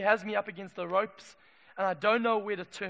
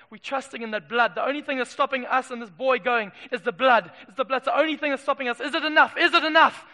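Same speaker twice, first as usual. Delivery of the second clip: rising from raised voice to loud, high pleading; desperate Israelites; 4 semitones higher, 9 dB louder than usual. Acted. Israelites hearing cries from the valley, desperate and building. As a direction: build into frantic, shouted questioning. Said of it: "they're going".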